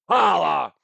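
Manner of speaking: loud high holler; catchphrase shout. Acted. Host opening the podcast with a loud holler. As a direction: bark out the catchphrase.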